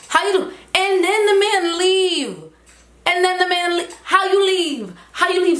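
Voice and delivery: put-on high-pitched childish wail; hammed-up, high tearful complaint; mock crying. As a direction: play up a left-behind child's protest. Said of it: "and I'm crying".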